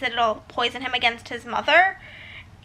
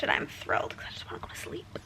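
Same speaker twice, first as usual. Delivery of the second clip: fast, much softer whisper; whispered aside; 14 semitones lower, 12 dB quieter, faster than usual. Real, not acted. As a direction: mutter it sarcastically under the breath. Sarcastic aside.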